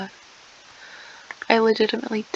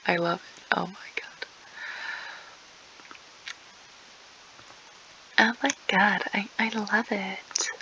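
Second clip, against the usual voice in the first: whisper; 8 dB quieter than usual. Acted. Whispered mock delight.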